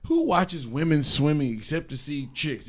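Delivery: normal talking voice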